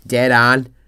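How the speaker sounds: loud imitated Belfast "dead on"; exaggerated Belfast catchphrase; Belfast accent. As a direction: mimic Northern Irish, ON opened towards "an". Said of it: "all you'd hear is this noise".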